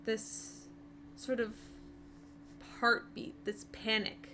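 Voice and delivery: breathy, hushed storytelling; hushed narration. A narrator's reading. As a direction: draw them into the dread quietly. GM narrates the creeping dread.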